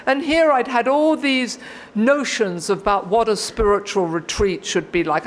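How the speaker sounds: ordinary talk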